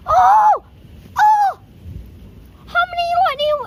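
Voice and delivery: jolted by a close strike, yelps; sharp high startled yell; startled scream. Real, not acted. Lightning striking all around.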